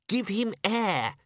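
insist, a little put out; flustered young wizard pleading for room; protesting. A narrator's reading. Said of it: "he protested".

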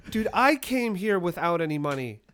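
loud, high-pitched outraged rant; pissed off. Retold or imitated. Storyteller replays his outburst at his friends, pissed off and yelling. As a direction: retell a furious rant at full volume. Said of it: "I am pissed off".

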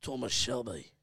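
low, quiet Birmingham accent; soft, deep put-on Birmingham voice; Thomas Shelby. Shown as a demonstration. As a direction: say it low in mock Brummie. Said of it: "trying to channel".

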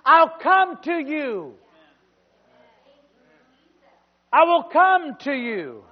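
loud, high preacher's cry; full-force preaching. Acted. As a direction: preach it hard to the crowd.

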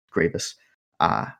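usual speaking voice

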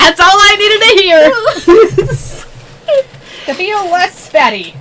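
slow words through laughter; laughing. Real, not acted. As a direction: laugh while you speak. Laughing fit.

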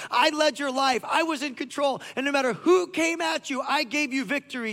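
pleading as the Father who gave everything; God speaking, impassioned. Acted. God pleading with King David, loud and high. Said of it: "He says".

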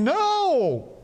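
put-on high defiant child shriek; toddler screaming no. Congregation mimicking stubborn toddler.

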